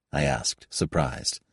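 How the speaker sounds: ordinary talk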